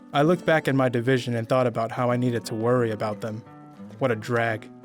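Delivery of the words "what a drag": ordinary delivery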